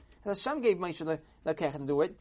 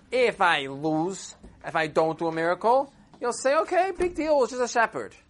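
loud, rising to a high dismissive finish; emphatic persuading; 7 semitones higher, 8 dB louder than usual. Acted. Shepherd persuades the Rav to send him, emphatic. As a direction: press the case with vigour. Shepherd argues his case.

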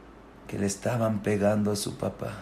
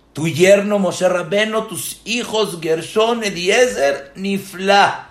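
rising fervor; 9 semitones higher, 8 dB louder, slower than usual. Acted. Preacher exalting Yitro's descendants.

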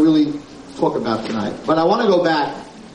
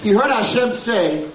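ordinary delivery

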